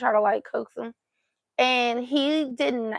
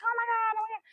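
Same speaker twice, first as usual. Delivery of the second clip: high, softer mimicry of excited children; excited kids; 12 semitones higher, 7 dB quieter, much faster than usual. Aunt imitating the kids in the car, excited. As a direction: squeal it quickly like overexcited kids. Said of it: "they're all excited".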